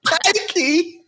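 put-on high-pitched Aussie accent; hammed-up Australian exclamation; mock Australian accent. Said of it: "No, wrong place".

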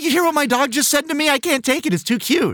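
high, fast gushing. Retold or imitated. Host retelling gushing about his dog, high and fast. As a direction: gush over something unbearably cute. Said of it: "I'm like".

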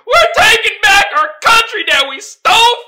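yelling while laughing; mock rally cry, cracking up; laughing shout. Mocking rioters, shouting through laughter.

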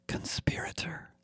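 whispered lizard hiss with a gurgle; hissing alien. A narrator's reading. Lizard alien accuses the startled narrator, hissing whisper. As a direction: hiss it softly like a reptilian alien. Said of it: "a hiss and gurgle tucked into the syllables".

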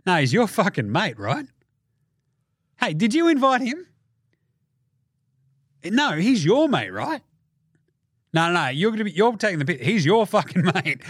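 animated, insistent denial; mock disowning. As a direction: pass the blame with a swinging, emphatic lilt. Acted denial of a disowned mate.

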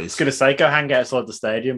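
ordinary talk, nothing marked

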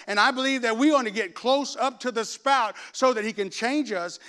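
loud, high, ringing preacher's build; fiery preaching. Acted. Pastor stirring his church about revival, preaching full force. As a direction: lift the whole room with it.

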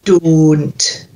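"Don't" slowly, with a pure long O; firm Scottish "Don't", no glide; Scottish O sound. Shown as a demonstration. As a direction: stretch the rounded vowel in "Don't". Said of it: "stick a D on the front of it".

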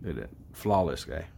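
low, soft self-admiring voice; smooth show-off. Pretend. Host showing himself to live viewers, low show-off voice. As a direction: drop it low and croon over yourself.

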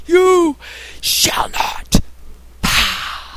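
whispered wizard proclamation; hushed mock Gandalf; Gandalf line. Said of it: "He says pause".

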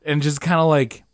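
ordinary talk

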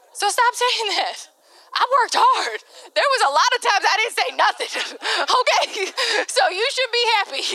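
high, indignant self-defence with laughs; mock indignant protest. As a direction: push back high and aggrieved, half laughing. Retorting to you always do this.

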